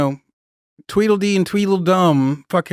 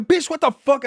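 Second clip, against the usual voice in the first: much higher, hammed-up outrage; mock outraged yell; 10 semitones higher than usual. Marine yelling at the recruit who pulled the trigger, mock outrage. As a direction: snap back at him in disbelief. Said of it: "I'm like".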